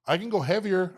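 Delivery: high-pitched retold boast; cocky eagerness. To the coach after the lift, a high eager boast.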